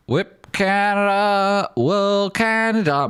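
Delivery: high, slow, hammed-up cheer for Canada; mock Canadian cheer. Streamer cheering a Canadian viewer in a hammed-up high voice. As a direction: stretch Canada out high and loud like a fan.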